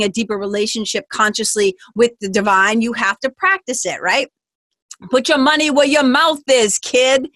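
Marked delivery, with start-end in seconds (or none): 5.1-7.3 tough talk. Pretend.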